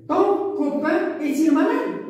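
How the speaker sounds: slow questioning rise on "malade"; question intonation. Shown as a demonstration. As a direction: model the question, climbing to "malade". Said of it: "Nous avons point d'interrogation".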